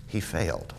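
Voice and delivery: usual speaking voice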